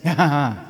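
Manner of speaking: scornful staged laugh; mocking laugh. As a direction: sneer a ha-ha-ha at him. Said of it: "they exclaimed".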